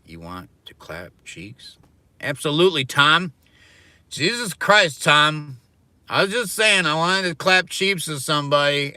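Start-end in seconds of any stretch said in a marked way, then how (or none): 2.2-8.9 high, loud and exasperated, retelling the exchange; all-out exasperated telling, far above normal level; loud exasperated rant. Retold or imitated. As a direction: vent it loudly with a pitched-up voice.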